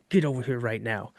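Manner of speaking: a quiet, seething mock threat; gritted teeth. Dad ordering his son over, low and seething through gritted teeth. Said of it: "he's gritting his teeth".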